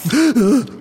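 sharp frightened gasp; shocked gasp. Acted. Fahrradschrauber spots the tentacle on his friend's head, startled gasp.

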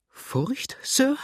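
high, startled question on each word; affronted, sharply rising exclamation; flaring up. Acted. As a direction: bristle at the very idea. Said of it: "rief Madame, heftig auffahrend".

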